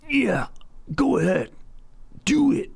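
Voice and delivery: high, strained movie-hero goading; mock a film star's odd yelling voice; high-pitched hammed-up dare, each phrase set apart; Brad Pitt impression. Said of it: "a Brad Pitt impression".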